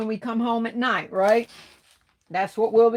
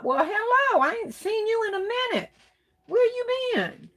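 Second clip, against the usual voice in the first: high-pitched, happily surprised hello; delighted greeting; 13 semitones higher than usual. Real, not acted. Host greets a viewer she ain't seen in a minute, delighted. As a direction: greet a long-lost friend way up high.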